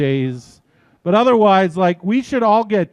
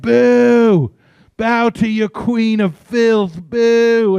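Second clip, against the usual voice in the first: boo like the Princess Bride crowd, hammed-up; mock booing crowd; 5 semitones higher, much slower than usual. Crowd booing the queen, hammed-up mock jeer. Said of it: "the scene in The Princess Bride where she's getting booed".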